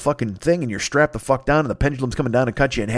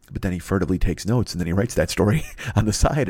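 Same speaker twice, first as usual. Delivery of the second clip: ordinary delivery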